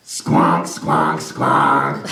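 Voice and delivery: low honking sax imitation; deep squonking horn noise; bad saxophone squonk. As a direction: blurt out a terrible sax note. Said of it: "he was like".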